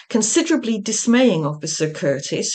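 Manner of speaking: ordinary delivery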